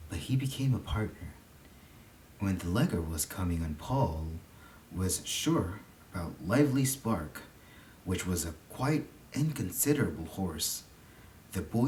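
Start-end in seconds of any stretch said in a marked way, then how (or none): none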